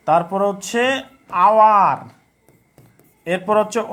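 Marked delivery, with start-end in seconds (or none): 1.5-2.0 আওয়ার loud, starting on "ah", no h; teacher dictating আওয়ার with silent h. Shown as a demonstration. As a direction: punch out আওয়ার from the open vowel. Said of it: "এইচের উচ্চারণ এখানে কি বলছে?".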